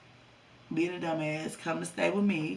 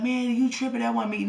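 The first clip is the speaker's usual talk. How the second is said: higher, a bit louder dismissive voice; mock denial; 6 semitones higher, 4 dB louder than usual. Boyfriend caught at the gas station, denying it, mock dismissive. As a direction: brush her off as if she's crazy.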